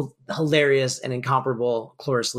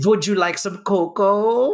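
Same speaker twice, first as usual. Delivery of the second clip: slow, higher, louder mock German accent, laughing through it; Frau Brucher German accent; 6 semitones higher, 4 dB louder than usual. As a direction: ham up a thick German accent like a stern old housekeeper. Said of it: "She's doing Frau Brucher from, from Young Frank".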